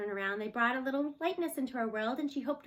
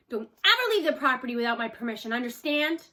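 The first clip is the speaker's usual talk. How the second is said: stern, fast and loud warning; stern farmer; 7 dB louder than usual. A narrator's reading. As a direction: warn her firmly and quickly. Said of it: "He had a serious expression on his face".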